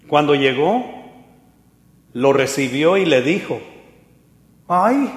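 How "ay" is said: high scornful "Ay"; sarcastic sigh. Retold or imitated. Mical greeting David, scornful sigh. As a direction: drip contempt on the "Ay". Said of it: "hasta con ese tono".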